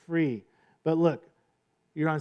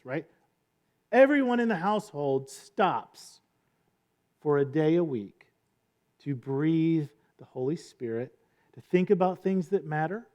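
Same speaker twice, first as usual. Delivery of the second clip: normal talking voice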